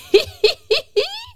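high giggling cackle; very high teasing witch laugh. A narrator's reading. Witch mocks the grumpy hedgehog, high cackle.

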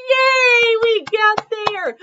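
high, loud, drawn-out yay; triumphant cheer. Real, not acted. Reader relieved to get through the line, cheering. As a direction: cheer in a high shout, stretched out.

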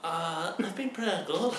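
high, thin cartoon girl voice. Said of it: "How come you sound like a cartoon character?".

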